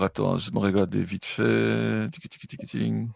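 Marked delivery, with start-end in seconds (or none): 2.1-2.7 unvoiced ticking mouth sound; whispered tick noise. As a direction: tick it off under breath. GM makes a checking sound.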